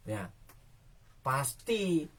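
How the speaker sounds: ordinary delivery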